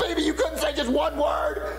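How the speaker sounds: angry. Acted. Wife lost the prize.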